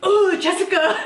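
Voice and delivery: imitated startled yelp, high-pitched. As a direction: mimic someone jumping back in disgust, laughing. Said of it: "He jumped. ... He went like this".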